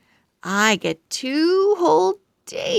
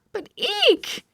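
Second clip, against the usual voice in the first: high squeaky eek of shock; startled eek. Acted. Pug finds water under bubbles, startled squeak. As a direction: squeak in sudden alarm.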